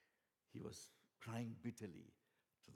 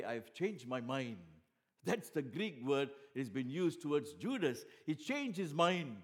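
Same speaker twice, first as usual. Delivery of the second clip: loud, high preaching build; full-force preaching; 7 semitones higher, 12 dB louder than usual. Acted. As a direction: hammer the example home to the congregation.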